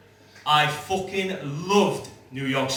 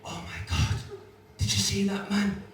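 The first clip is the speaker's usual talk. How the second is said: gossiping Americans; 4 semitones higher than usual. Retold or imitated. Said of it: "Americans were going".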